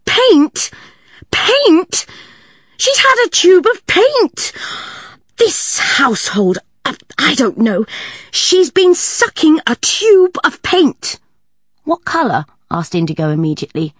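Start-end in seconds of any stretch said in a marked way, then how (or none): 0.0-11.2 much higher and louder, slow and aghast; horrified shriek. A narrator's reading. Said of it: "absolutely horrified". 11.8-12.5 higher quick question; curious boy; keen child; eager boy. A narrator's reading. Indigo asks immediately.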